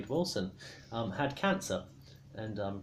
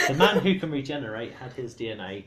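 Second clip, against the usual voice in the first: laughing; 7 dB louder than usual. Real, not acted. Re-explaining while laughing.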